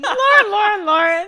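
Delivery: loud high teasing call; laughing teasing. Real, not acted. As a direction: tease her name, laughing. Teasing friend by name.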